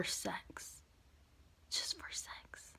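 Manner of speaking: breathy whispered aside, dropping to almost nothing. Real, not acted. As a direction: hush it out in disgust. Disgusted aside about guys.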